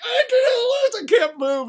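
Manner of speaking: high, drawn-out helpless wail; hammed-up shriek, easing at the end; mock screaming. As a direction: wail it as a trapped, beaten god. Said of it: "screaming that he won".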